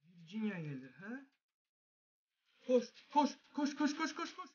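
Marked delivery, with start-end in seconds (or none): 2.7-4.5 frantic rising high urging; panicked, climbing higher each time; being chased. Real, not acted. Frantic urging while fleeing.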